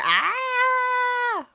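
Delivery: high excited shriek; excited scream. Real, not acted. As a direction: let out a loud happy scream. Excited about Jamaica trip.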